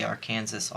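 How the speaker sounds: "Arkansas" said like "ar-KANSAS", softly; soft "Arkansas" with the final S sounded; mispronounced Arkansas. Shown as a demonstration. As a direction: quietly say "Arkansas" to rhyme with "Kansas". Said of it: "I just wanna say".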